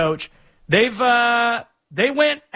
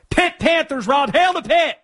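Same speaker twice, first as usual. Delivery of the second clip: excited greeting; 8 semitones higher than usual. Real, not acted. Greeting a Pitt fan caller.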